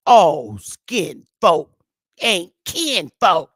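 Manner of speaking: slow, punchy imitation of a Tennessean accent. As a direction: draw out each word in a Tennessean accent, loudly. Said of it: "with her Tennessean accent".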